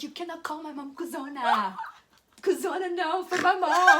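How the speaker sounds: mock scolding through laughter; protest, cracking up as you retell it; amused, pleading retold objection; laughing protest.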